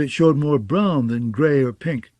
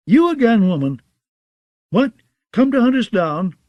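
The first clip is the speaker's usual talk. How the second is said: higher, jeering voice; mocking outlaw; 8 semitones higher, 4 dB louder than usual. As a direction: taunt her with glee.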